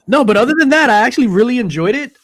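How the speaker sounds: loud, high, eager; bursting out, keen to share; excited. Real, not acted. Loud change of topic.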